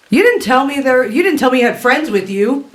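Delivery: high woman's voice, accusing; woman calling out. Acted. Orc woman scolds Talrin about his hidden friend, high-pitched. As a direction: call it out high, as a miffed woman. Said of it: "the woman's voice calls out".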